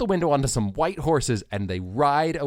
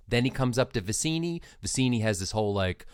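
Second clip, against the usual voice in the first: normal talking voice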